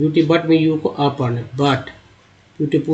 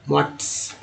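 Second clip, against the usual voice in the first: whispered repeat; 8 dB quieter, slower than usual. Shown as a demonstration. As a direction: whisper मोट्स as a soft echo. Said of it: "teacher repeats the word".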